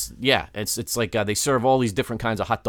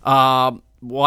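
ordinary delivery

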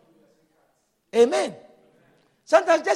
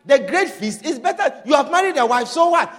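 ordinary talk

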